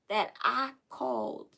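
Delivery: ordinary talk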